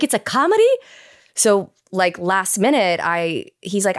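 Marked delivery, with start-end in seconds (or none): 0.0-0.7 high-pitched, incredulous question; retold surprise. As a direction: re-enact a startled discovery, voice leaping up. Retelling surprise at learning the genre.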